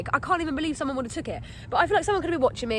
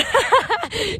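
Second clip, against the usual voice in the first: high, loud burst of laughter; sudden bright cackle; real laugh; 9 semitones higher, 5 dB louder than usual. Real, not acted. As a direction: crack up at your own joke. Laughs at own joke.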